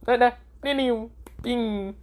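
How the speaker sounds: high, sing-song mocking nah nah; teasing taunt. Boruto taunting while running off.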